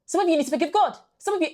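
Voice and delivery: high, loud preacher imitation; mimicking the preacher. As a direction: mimic a fiery preacher calling out the crowd. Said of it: "Hayley Rolanda was literally like".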